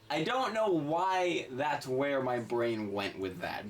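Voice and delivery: normal talking voice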